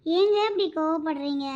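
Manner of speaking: usual speaking voice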